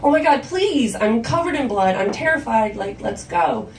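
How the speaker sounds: acted-out desperate daughter; frantic pleading. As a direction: plead in a breathless rush of words. Daughter begs to be driven.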